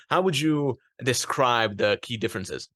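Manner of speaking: normal talking voice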